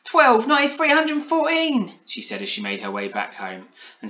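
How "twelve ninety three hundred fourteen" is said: very high, loud number babble; Polly overexcited. A narrator's reading. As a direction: squeal it out, drawing out the end. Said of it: "leaving Polly too excited for words".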